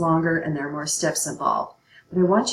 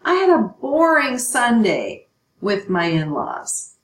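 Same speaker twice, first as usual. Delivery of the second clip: mock bored student example; 6 semitones higher, 6 dB louder than usual. Shown as a demonstration. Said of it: "we created a picture".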